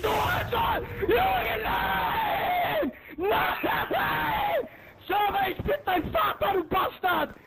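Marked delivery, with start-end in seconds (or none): 0.0-4.7 high shrieking rage; raging scream. Real, not acted. Gamer dies in the match and screams high in rage. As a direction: scream as if you just got killed.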